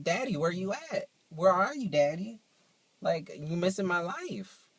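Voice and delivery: a badly faked Southern twang calling for Daddy; Southern twang attempt. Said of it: "I was trying to do my twang".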